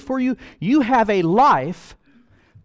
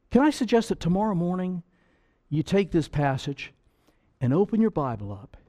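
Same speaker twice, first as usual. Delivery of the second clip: normal talking voice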